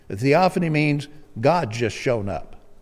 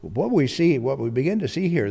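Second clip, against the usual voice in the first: normal talking voice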